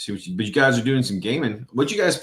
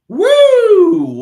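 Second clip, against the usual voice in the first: a huge high-pitched woo; whooping in disbelief at a wild claim; loud whoop; 16 dB louder than usual. Real, not acted. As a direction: let out a big incredulous whoop. Reacting to a crazy claim.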